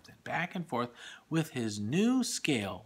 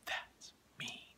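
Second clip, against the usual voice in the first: whispered; 13 dB quieter than usual. Real, not acted. Child whispers comment during story.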